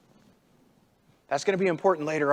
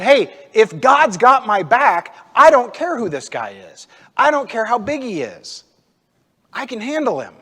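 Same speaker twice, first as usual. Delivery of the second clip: loud, higher, defiant assurance; bold confidence; 4 semitones higher, 6 dB louder than usual. Acted. David sure that God has his back, boldly confident. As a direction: declare it fearlessly, easing off at the end. David's fearless answer to giant.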